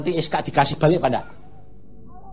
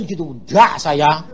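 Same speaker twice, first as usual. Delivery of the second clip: normal talking voice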